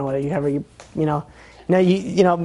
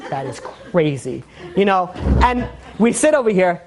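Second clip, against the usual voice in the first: ordinary delivery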